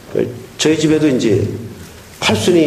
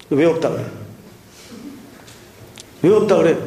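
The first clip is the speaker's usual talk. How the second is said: ordinary talk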